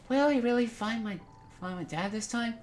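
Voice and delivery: put-on high-pitched little boy voice; child character Joel. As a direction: voice a hopeful young boy, pitched well up. Voices young Joel's game dialogue.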